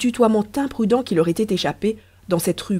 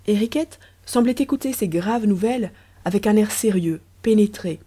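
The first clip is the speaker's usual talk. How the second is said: usual speaking voice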